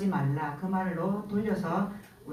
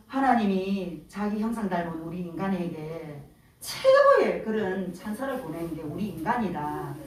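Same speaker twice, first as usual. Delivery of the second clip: ordinary talk, nothing marked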